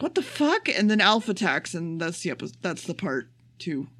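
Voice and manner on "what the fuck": high, mock-startled what the fuck; a lightly voiced stunned queen; queen's shocked outburst. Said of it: "And the queen is like".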